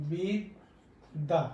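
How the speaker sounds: বিদ্যা drawn out slowly with a doubled d; slow demo pronunciation. Shown as a demonstration. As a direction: stretch বিদ্যা as "bid-da". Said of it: "আমরা বলি হচ্ছে". (ধীরে বিদ্দা উচ্চারণ)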